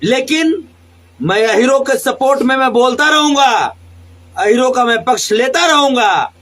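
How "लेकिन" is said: ordinary talk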